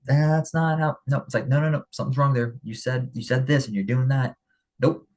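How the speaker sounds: calling someone out. Retold or imitated. To a friend breaking their word, a brisk callout. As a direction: firmly catch them out, quick and direct. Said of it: "see it from afar and go".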